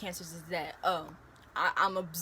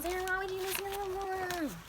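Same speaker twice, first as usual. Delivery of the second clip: high mocking whiny voice; mocking critic; 12 semitones higher than usual. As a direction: ham up the nagging critic. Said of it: "hitting me up talking about".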